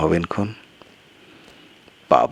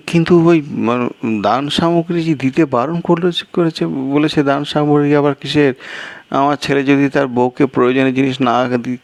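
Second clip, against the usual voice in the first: higher and quicker, eager to explain; the guest rattles on, pitched up; fast, higher guest voice; higher-voiced guest; 8 semitones higher than usual. Guest character voice.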